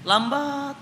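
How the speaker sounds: "lambat" stretched long on a high held note; slow drawn-out word. Shown as a demonstration. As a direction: draw out "lambat", pitched high. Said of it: "Ada yang lebih lambat disebut".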